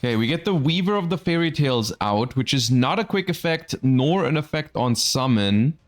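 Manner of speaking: ordinary talk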